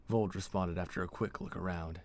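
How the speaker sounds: ordinary delivery, nothing marked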